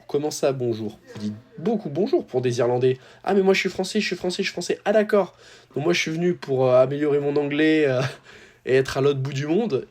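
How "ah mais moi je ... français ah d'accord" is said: higher, singsong imitation of coworkers; mock surprised coworkers. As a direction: play the chorus of French staff, pitched up. Everyone answering they are French.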